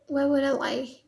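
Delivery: ordinary delivery, nothing marked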